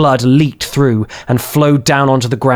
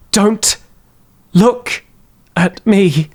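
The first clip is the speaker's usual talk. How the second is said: weaker in spirit; 8 semitones higher, much slower than usual. A narrator's reading. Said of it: "much weaker in spirit this time".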